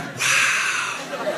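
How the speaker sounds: hushed, whispered awe; soft, stunned mock admiration; almost impressed. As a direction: breathe it out in quiet amazement. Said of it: "he was almost impressed".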